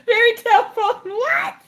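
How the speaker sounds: high-pitched laughing squeal. Real, not acted. Cracking up over her story, helpless laughing. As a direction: squeak it out through real laughter.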